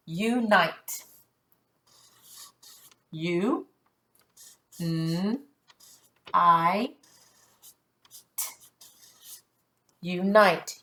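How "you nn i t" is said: "U, N, I, T" as isolated sounds, long pauses; sounding out. Shown as a demonstration. Teacher checking the word sound by sound, sounding out. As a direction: say "U, N, I, T" one sound at a time, n hummed. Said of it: "There's one sound".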